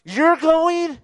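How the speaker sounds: high-pitched disbelieving question; incredulous angels. Acted. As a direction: cry it out in astonished disbelief. Angels react incredulously to God's plan.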